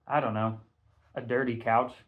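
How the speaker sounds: usual speaking voice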